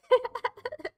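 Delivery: high burst of giggles; laughing. Real, not acted. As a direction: crack up at your own idea. Amused by own accent idea.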